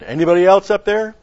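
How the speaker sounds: monk hanging from a cliff, shouting in a panic; panicked call. Acted. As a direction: yell it in sudden panic, hoping for anyone else. Punchline, monk calls out again.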